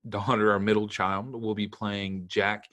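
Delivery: usual speaking voice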